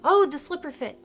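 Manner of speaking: usual speaking voice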